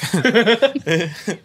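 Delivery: high-pitched burst of laughter. Real, not acted. Cracking up at his own joke about the word, high laugh.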